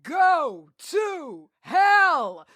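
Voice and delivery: high, drawn-out ring announcer call; boxer announcer voice. Pretend. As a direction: stretch every word like a fight announcer. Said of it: "the famous boxer announcer voice".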